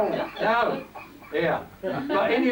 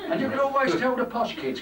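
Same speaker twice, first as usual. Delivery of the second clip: usual speaking voice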